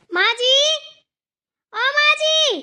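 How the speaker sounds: loud, high summons; angry calling out. Acted. Daughter-in-law calls for mother-in-law, angry calling out. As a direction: call out loudly, pitch climbing. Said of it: "इतने गुस्से में क्यों है".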